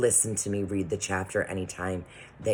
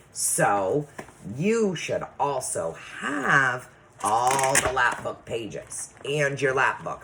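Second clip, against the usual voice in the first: ordinary talk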